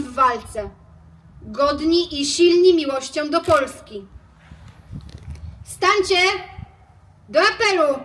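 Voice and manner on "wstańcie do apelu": high ringing summons; ceremonial call. Real, not acted. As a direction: call the fallen to rise.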